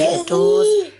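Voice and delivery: high, slow delighted gush. Real, not acted. Gushing about daughter.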